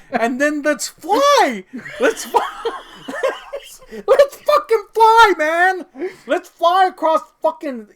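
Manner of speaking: high, loud, slow and laughing; mock giddy cheer. Mocking the ghost who keeps flying Scrooge away, giddy and loud. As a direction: ham up the ghost's glee about flying.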